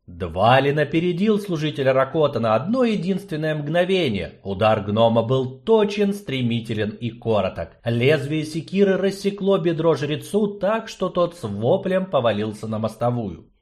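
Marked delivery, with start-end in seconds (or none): none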